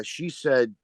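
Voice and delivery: ordinary talk, nothing marked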